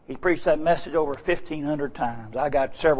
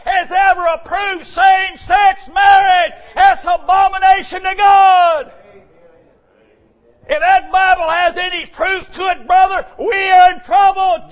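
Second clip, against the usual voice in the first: high, loud fired-up sermon cadence; preaching at full force; 13 semitones higher, 7 dB louder than usual. Acted. Preacher calling to the congregation, loud and fervent.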